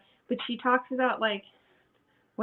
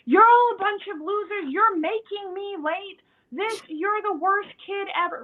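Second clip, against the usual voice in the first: mock yelling parent; 9 semitones higher than usual. Shown as a demonstration. Parent lashing out at late kids, hammed-up high yell. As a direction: ham up the blaming outburst. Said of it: "you didn't say".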